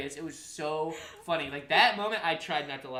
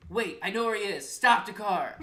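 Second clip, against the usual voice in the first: higher, louder mimicked cry to halt; urgent call; 6 semitones higher, 9 dB louder than usual. Youngest brother yelling at mom to stop the car, urgent. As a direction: imitate a sudden urgent call from the back seat.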